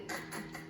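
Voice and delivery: "k" as a voiceless k burst, no vowel; just the unvoiced "k" sound, three times. Shown as a demonstration. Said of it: "starts with the k".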